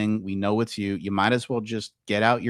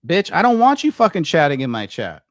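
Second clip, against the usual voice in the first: angry outburst; 5 semitones higher, 7 dB louder than usual. Real, not acted. Telling off a chatter.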